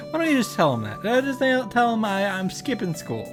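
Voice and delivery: ordinary talk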